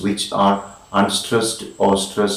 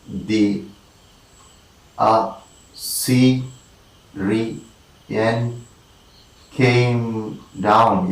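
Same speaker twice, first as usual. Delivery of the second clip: very slow, long gaps between syllables; syllable-by-syllable scansion; much slower than usual. Shown as a demonstration. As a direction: dictate the verse one syllable at a time. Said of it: "two unstressed followed by one stressed".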